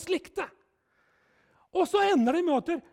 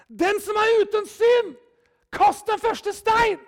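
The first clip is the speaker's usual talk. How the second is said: louder, slow and weighty verdict; solemn pronouncement; 4 semitones higher, 5 dB louder, slower than usual. Retold or imitated. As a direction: deliver it slowly as a final word. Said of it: "så sa han". (Jesus til fariseerne, langsomt og høytidelig)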